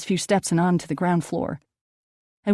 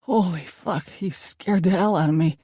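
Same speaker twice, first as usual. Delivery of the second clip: usual speaking voice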